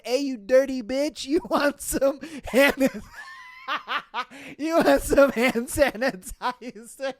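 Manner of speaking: helpless laughing retelling; quoting through laughter. Retold or imitated. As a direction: deliver the taunt while losing it. Quotes insult while cracking up.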